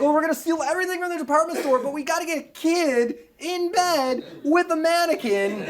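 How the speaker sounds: high, loud mock plotting; mock scheming thieves. Mocks thieves planning their heist.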